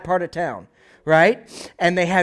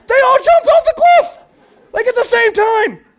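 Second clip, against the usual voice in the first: much higher, louder, squeaky retelling; squealing excited tale; 9 dB louder than usual. Retold or imitated. Herdsman telling family the pigs jumped off the cliff, squeaking with excitement. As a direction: blurt out the unbelievable news.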